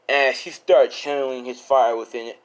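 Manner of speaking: usual speaking voice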